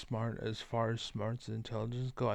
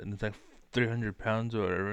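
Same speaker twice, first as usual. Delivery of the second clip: normal talking voice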